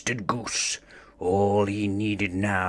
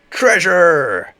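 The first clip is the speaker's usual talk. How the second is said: much higher, louder squeal; ecstatic shout; 10 semitones higher, 9 dB louder than usual. Acted. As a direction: burst out in greedy delight. Building excitement.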